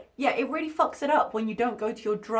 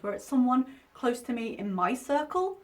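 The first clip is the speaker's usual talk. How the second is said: ordinary talk, nothing marked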